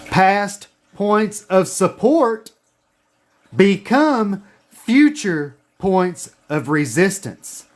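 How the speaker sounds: slow, deliberate dictation again; dictation pace. Shown as a demonstration. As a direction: spell it out slowly so they can write. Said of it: "Write this down".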